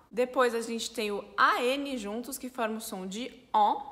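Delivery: ordinary talk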